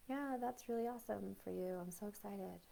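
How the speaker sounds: soft, flat, unconvinced congratulations; fake enthusiasm. Mimicking someone faking joy at good news, soft and flat. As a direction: say it like you don't mean a word. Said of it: "the person that's like".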